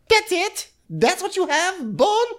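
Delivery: very high squeaky taunt; laughing taunt up high; Fatum mocking. Fatum mocking the bone prop.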